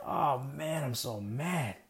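understated, drawn-in irritation without raising the voice; mild mock anger. Retelling his golf frustration to listeners, mildly mad. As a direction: retell being mad with no real fury. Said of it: "And I was mad".

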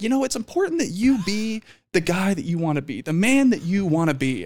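high earnest life-coach voice; imitating her. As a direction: voice her preachy advice up high. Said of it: "she was just like".

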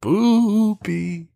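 squeaky playful spoopy; high silly spoopy. Acted. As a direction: pitch it way up, goofy. Cohost jokes on spooky.

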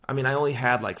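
usual speaking voice

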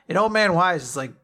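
ordinary talk, nothing marked

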